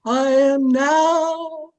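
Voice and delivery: very slow, droning medium in a trance. Acted. Medium announces she is in a trance, slow hollow-theatrical intoning. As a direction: drag every word out like a voice from beyond. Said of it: "I will go into a trance".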